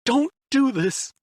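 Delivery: high, slow plea; pleading. A narrator's reading. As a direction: beg her. Otacon begging.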